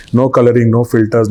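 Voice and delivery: ordinary talk, nothing marked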